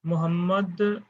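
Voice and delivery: ordinary delivery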